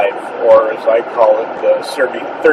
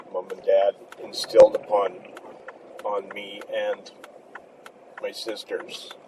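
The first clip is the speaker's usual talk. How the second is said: emotional, fading voice; 3 semitones lower, 9 dB quieter than usual. Real, not acted. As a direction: let the voice drop away with feeling. Emotional about leaving loved job.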